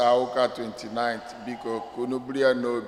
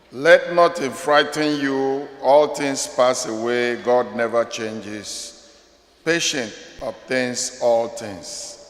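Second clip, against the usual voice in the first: prayer; 3 dB louder than usual. Acted. Priest leading the congregation in prayer, recited prayer voice. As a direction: pray it out to the congregation, then let it fade.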